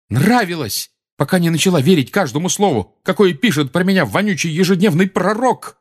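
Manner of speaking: heated cry, only lightly breaking; shouting, voice cracking. A narrator's reading. Harry lashing out about the Daily Prophet, mildly shouted. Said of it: "крикнул Гарри срывающимся голосом".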